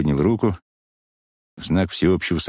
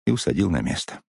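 usual speaking voice

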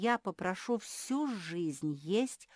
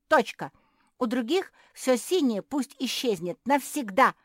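loud, high, insistent tirade; yelling; 7 semitones higher, 13 dB louder than usual. A narrator's reading. Sister ordering the fairy to grant her wish, yelling. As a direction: keep shouting demands right to the final order. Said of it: "закричала Жози".

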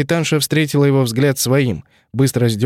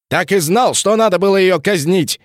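angry bark; 8 semitones higher than usual. Acted. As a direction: bark it in fury. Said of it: "рявкнул эмиссар Харальд".

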